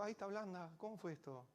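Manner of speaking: put-on high, soft surprised girl voice; surprised little girl. Little girl amazed at the soft potato, high and hushed. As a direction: gasp it out in wonder, up high.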